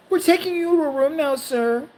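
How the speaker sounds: high, singsong placating nurse. Acted. Nurse tells old man about a room, high and placating. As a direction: humour him in a high official voice. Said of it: "and she goes".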